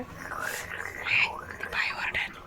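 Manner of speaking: slow hushed whisper of a warning. Real, not acted. Mother warning her child the lady will scold, in a slow whisper. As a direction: lean in and whisper it slowly.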